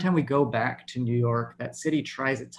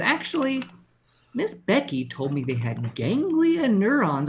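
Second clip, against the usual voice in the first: put-on high-pitched correcting kid voice; know-it-all young son; 9 semitones higher than usual. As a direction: imitate a child setting Daddy straight. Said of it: "He said".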